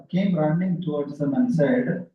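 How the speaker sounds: ordinary talk, nothing marked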